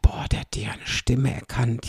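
amazed thought in a whisper; hushed inner voice, surprised. Retold or imitated. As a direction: whisper it to yourself, astonished. Retells her own amazed thought.

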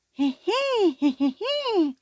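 high tinkly giggle; fairy giggle. Acted. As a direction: giggle like a tiny garden fairy.

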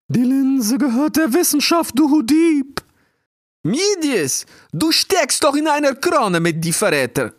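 high, loud scolding owl voice; empörte Eule. A narrator's reading. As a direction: screech the accusation like an outraged owl. Eule beschimpft den Dieb. (empört kreischende Eulenstimme)

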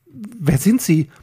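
slow, high frightened question; startled. A narrator's reading. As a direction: ask it taken aback. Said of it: "fragte Peter erschrocken".

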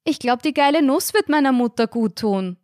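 high, loud public answer; loud reply. A narrator's reading. Daughter answering landlord across the inn, loud and high. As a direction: call it out for all to hear. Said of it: "antwortete Carina lautstark".

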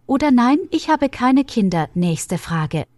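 normal talking voice

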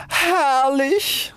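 very high, stretched exclamation; exulting cry. A narrator's reading. Rocket flying into the air, high rapturous cry. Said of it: "rief sie".

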